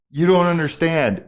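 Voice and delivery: loud, high insistence; worked-up insistence. Retold or imitated. Excited model to the driver, worked-up insistence.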